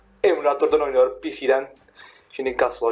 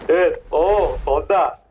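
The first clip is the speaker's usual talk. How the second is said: ordinary talk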